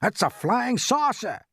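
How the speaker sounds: high, slow crowd cry; excited shout. A narrator's reading. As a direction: shout it high, drawing out the words. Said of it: "they shouted".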